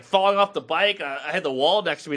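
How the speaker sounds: normal talking voice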